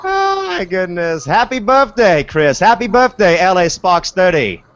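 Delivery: high, excited attempt at an Aussie accent; Aussie impersonation. Host wishing Chris happy birthday, mock Aussie accent. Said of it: "do my best Aussie impersonation".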